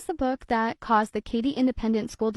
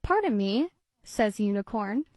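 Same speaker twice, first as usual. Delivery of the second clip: put-on lively read-aloud voice for children; storybook reading. A narrator's reading. Narrator reading a kitty tale to children, lively storybook voice. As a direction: read it like bedtime story time. Reads picture book aloud.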